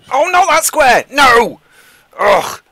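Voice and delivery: high, loud cry of dismay; dismayed shout. Real, not acted. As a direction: yelp at the bad roll. Dice landing on a bad square.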